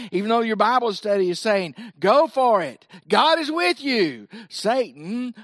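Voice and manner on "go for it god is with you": raised, emphatic encouragement; loud, rising exhortation; urging on. Acted. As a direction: lift it into a rousing cheer. Voicing encouragement from Bible study.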